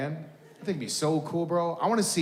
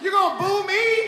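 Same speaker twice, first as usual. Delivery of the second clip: high-pitched, incredulous mock protest; mock outrage; 13 semitones higher than usual. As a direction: protest to the crowd in mock disbelief. Mock outrage at being booed.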